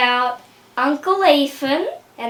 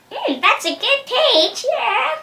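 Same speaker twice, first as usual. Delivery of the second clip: much higher, light and sing-song; high chirpy Kim voice; 10 semitones higher, slower than usual. Retold or imitated. Kim tasting the peach.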